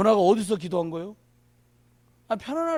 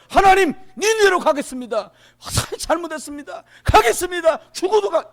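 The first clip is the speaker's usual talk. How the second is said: high, loud pleading to God; desperate shouted prayer; 8 semitones higher, 10 dB louder than usual. Acted. Preacher acting Jonah begging God from the fish, shouting desperately. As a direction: cry out in acted surrender. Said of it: "막 소리 질러 싸고 막 간절히".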